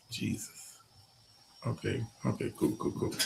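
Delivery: hushed aside to himself; whispered muttering. Real, not acted. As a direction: breathe it out as a soft mutter. Muttering to self off mic.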